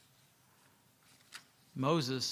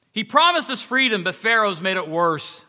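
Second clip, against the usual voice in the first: loud, higher bitter complaint; shouting; 5 semitones higher, 6 dB louder than usual. Acted. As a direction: yell it at the crowd. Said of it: "he shouted".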